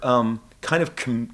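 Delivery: usual speaking voice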